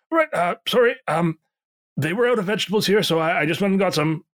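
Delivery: high, fast breathless cook; hurried innkeeper. Acted. Pierre rushing back with vegetables.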